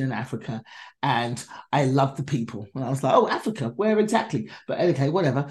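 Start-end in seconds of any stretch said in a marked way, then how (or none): 3.1-4.5 pitched-up mock surprise; sarcastic. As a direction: ask it like the question is obvious. Sarcastic thought about "Africa.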